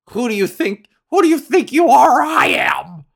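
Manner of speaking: high mock shout, building from the first attempt; imitated bowler's outburst. Host quoting bowler's legendary line, mock triumphant shout. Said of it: "when he goes".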